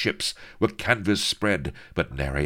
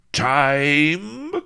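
loud booming villain call; 11 semitones higher, 7 dB louder than usual. Acted. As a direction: boom it out from afar. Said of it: "boomed the distant brazen voice".

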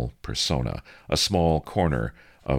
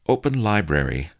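commanding; 7 semitones higher, 4 dB louder than usual. Acted. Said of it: "she pronounced".

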